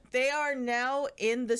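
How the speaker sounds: talking through real laughter; high laugh breaking into the words. Real, not acted. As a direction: laugh while recounting the scene. Laughing at absurd plot.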